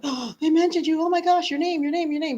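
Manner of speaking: high-pitched gushing imitation; excited fans. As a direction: mimic amazed people repeating the news. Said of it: "got a lot of people curious".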